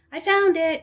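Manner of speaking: high, loud call across the classroom. A narrator's reading. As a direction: call it out like an eager pupil. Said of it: "called Ben".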